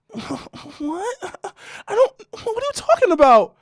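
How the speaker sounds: high mock girlfriend. Imitating girlfriend playing innocent, high voice. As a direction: play her dumb and high. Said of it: "She goes".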